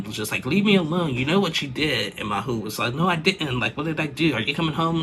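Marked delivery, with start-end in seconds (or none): 0.4-2.2 high, loud mock yell at her husband; wife screaming at her husband in the street; retold scream. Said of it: "She was screaming at him". 2.9-5.0 retold protest. Husband voiced in street fight.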